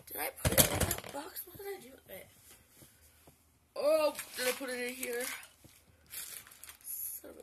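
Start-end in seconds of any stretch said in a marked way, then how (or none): 0.1-5.2 frantic search. Real, not acted. Frantically searching for lost item.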